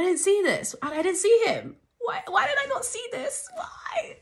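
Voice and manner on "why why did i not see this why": high, cracking mock anguish, fading off; hammed-up despair at missing the shoot; mock wail. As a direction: wail like it's a tragedy. Wails about missing filming.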